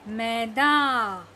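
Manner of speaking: Maida drawn out slowly and falling; slow reading. Shown as a demonstration. Teacher modelling a slow read of the word. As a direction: stretch out maida for the class. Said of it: "धीरे धीरे पढ़ने हैं".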